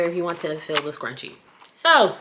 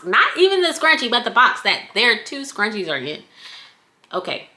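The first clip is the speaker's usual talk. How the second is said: normal talking voice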